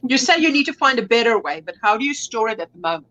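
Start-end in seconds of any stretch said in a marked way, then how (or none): none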